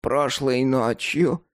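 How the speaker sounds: slow, every word spelled out; carefully enunciating. Acted. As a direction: pronounce each word with care. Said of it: "старательно выговаривая каждое слово".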